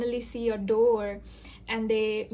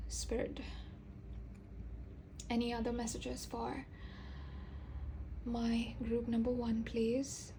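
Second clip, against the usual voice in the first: softer, almost whispered request; hushed plea to Spirit; 10 dB quieter than usual. Real, not acted. Tarot reader asking Spirit for more messages, hushed and soft. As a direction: ask Spirit quietly, in the hush of a reading.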